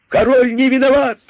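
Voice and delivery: высоко, громко, радостно кричат; ликующий выкрик толпы; крик толпы. Acted. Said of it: "закричали кролики с удвоенной энергией". (кролики с радостью кричат в защиту короля, высоко и громко)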